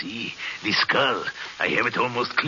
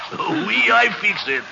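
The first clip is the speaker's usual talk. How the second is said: normal talking voice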